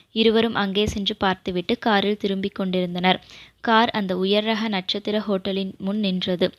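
plain reading voice

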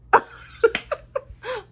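long, high burst of laughter. Real, not acted. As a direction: crack up at how absurd it was. Laughing at the memory.

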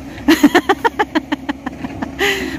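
high bubbling laugh. Real, not acted. Amused as Mila builds a shelter of branches, high laugh.

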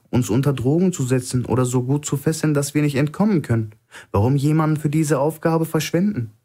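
a captive calmly needling his guard; Stone, calm hostage. Acted. Narrator voices hostage Stone.

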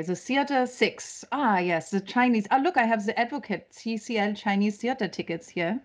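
put-on German accent, reading aloud. Shown as a demonstration. Proving to the panel she can do it, German accent. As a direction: read it out in your best German accent. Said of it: "I do have a good one. I'll pull it out".

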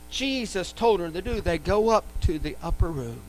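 ordinary talk

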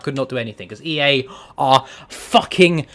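ordinary talk, nothing marked

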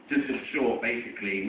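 ordinary delivery

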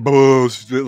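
put-on Boston said as "Bahston" in a Boston accent. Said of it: "Boston accent's coming out there".

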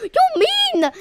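very high shocked yell; shocked shout. Real, not acted. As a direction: shriek in disbelief. Shocked at prank.

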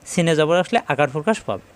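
ordinary delivery

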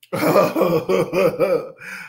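long run of pulsing laughter; helpless laugh. Real, not acted. Reviewer cracking up at bad music, helpless laugh. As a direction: let the laugh roll out uncontrolled. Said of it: "I'm glad I'm laughing about it".

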